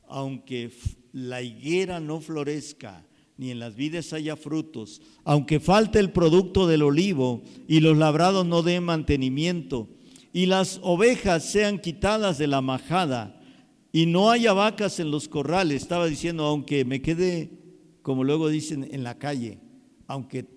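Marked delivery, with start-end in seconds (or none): 5.2-15.8 loud, ringing sermon voice; full-force preaching. Acted. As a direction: preach it out with fire.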